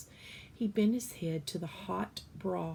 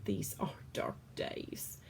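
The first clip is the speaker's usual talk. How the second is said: low, soft whisper; sad whisper; 9 semitones lower, 6 dB quieter than usual. Acted. Cook lamenting dark days, low sad whisper. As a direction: breathe it out sadly.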